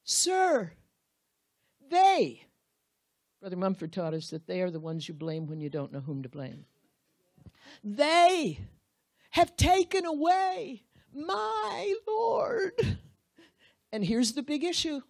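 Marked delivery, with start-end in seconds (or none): 0.0-2.4 high, loud pleading voice; pleading Mary. Acted. Grieving Mary begs the stranger, high and loud. Mary's plea acted out. 7.8-13.0 high, slow, crying plea; tearful plea. Acted. Mary weeps to the stranger about her missing Lord, crying.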